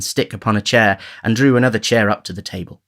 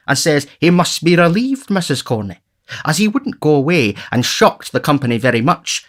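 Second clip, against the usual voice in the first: pompous beadle, higher and louder; Bumble indignant; 6 semitones higher, 4 dB louder than usual. Acted. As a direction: tell the outrage with relish. Bumble tells an anecdote.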